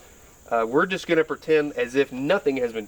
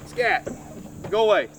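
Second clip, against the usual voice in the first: shooing an animal; 7 semitones higher than usual. Real, not acted. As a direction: shoo them away, alarmed. Shooing wasps away.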